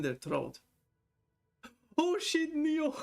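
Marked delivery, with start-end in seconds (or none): 2.0-2.9 high, bright burst of delight at a name; suddenly thrilled to see a friend pop in; excited greeting. Real, not acted. Streamer spotting her friend Neo in chat, excited high greeting. Spots a viewer arriving in chat.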